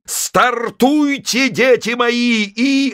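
high, slow jubilant shout; preacher exulting at liftoff; ecstatic. Acted. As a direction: stretch it out in rapture. Preacher's ecstatic launch cry.

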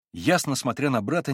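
ordinary delivery

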